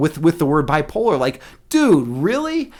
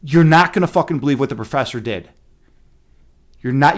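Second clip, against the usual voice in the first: ordinary delivery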